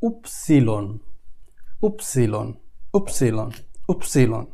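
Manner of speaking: উপসিলন said low and soft, falling at the end; উপসিলন spoken quietly and clearly; German Y sound. Shown as a demonstration. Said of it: "কয়েকবার একটু try করলে".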